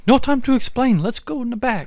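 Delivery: high hammed-up heroine voice, rushing; urgent movie heroine. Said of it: "And she's like".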